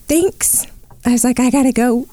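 high-pitched thanks, about to cry; retold near tears. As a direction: imitate a hasty goodbye before the tears come. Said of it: "And I just started crying".

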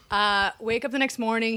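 plain reading voice

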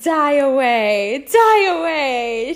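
slow, loud, high drawn-out call; theatrical urging. Acted. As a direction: send it off with a grand, swooping cry. Cheering on the dying leaves.

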